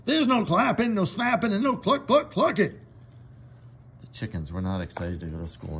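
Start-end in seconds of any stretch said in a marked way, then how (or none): 0.1-2.7 much higher and louder, mimicking the moo; Farmer Brown mocking moos. Farmer Brown lists banned cow noises, mock mooing. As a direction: ham up the forbidden noises. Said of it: "said Farmer Brown".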